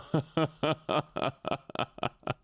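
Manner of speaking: long staccato laugh; real laugh. Real, not acted. Streamer reacting to the shaken cage, laughing. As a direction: laugh out in a string of short bursts.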